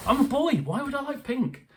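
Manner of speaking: high, hammed-up outrage; mock protest, pitched high; mock indignant. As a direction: play the offended little boy. Mock indignant about pink.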